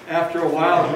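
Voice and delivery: ordinary delivery, nothing marked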